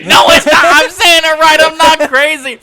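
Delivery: very high, full-volume yelling; Auntie. Retold or imitated. Imitating Auntie arguing for an hour, frantic high yell. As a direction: yell it frantically, insisting to everybody. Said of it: "he was just yelling to everybody".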